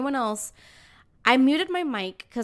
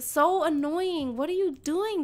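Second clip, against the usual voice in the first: normal talking voice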